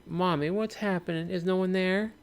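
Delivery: put-on high child's voice; little child. Streamer retelling the boy's question, high child voice. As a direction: repeat it as a small kid. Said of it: "we heard a little kid saying".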